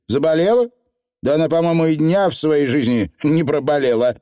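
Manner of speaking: indignant, scoffing reply; scornful snort. Acted. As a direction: huff it out in disbelief. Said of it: "фыркнула женщина таким тоном".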